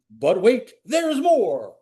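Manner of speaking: high, punchy TV pitchman; infomercial voice. Pretend. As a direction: sell it like a late-night ad. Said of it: "Infomercial voice".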